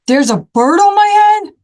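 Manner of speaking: high, loud startled question; shocked disbelief, voice jumping up; alarmed. Acted. Elephant finding out about the bird, shocked and loud.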